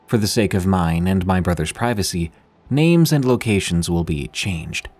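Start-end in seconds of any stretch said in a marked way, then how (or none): none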